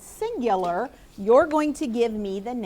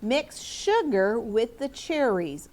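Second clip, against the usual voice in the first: slow dictation; slower than usual. Shown as a demonstration. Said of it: "I disagree".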